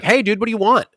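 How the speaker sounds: a hint of a bored barista; barista's reply. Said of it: "he's like".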